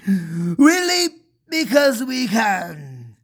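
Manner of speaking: put-on slow, drawn-out southern accent; lightly put-on southern drawl, slow. Elves answering why they leap, in a mild southern accent. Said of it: "in their southern accent".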